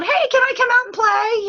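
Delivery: put-on high, bright-eyed kid asking to play; child voice. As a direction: call out like an eager little kid. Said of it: "it's like going".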